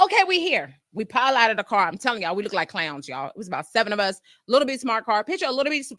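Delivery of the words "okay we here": high, bright announcement; the friend's high cheery announcement; cheery arrival. Retold or imitated. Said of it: "She said".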